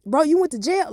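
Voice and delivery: put-on high excited gossiping kids; nosy classmates. Classmates grilling her at school, high and eager. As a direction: pile on the questions, way up high. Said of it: "everybody asking me questions".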